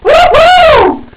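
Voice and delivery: very high, loud whoop; excited cheer. Real, not acted. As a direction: whoop with real joy. Cheers the car win.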